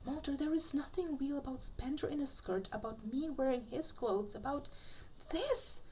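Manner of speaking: rising to a high squeak on this; exasperated outburst. Acted. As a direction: build until the last word jumps way up. Scully exasperated, shaking pigtails.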